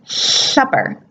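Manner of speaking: supper with the air escaping on the sides of the tongue; slushy sideways S in supper; lateral lisp. Shown as a demonstration. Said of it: "if a child has a lateral lisp".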